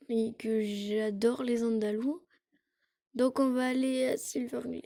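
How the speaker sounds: ordinary talk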